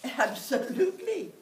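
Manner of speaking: high, loud happy yes; mother eagerly agrees; cheerful. Acted. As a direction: beam it out.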